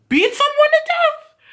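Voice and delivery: very high, acted incredulous question; high-voiced confrontation, retold; incredulous challenge. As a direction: pitch it way up in mock disbelief.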